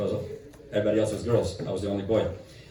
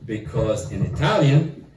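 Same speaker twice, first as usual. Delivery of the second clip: normal talking voice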